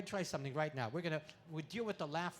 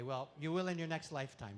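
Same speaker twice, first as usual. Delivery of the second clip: ordinary talk, nothing marked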